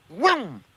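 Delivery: swooping "whoom" of a passing truck; imitated whoosh. Storyteller mimics the truck blowing past, imitated whoosh. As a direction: make the whoom rise and fall quickly.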